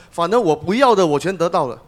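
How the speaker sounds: usual speaking voice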